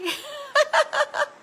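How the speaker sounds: high, loud burst of laughter; real laugh. Real, not acted. Laughs after quoting Scott's question.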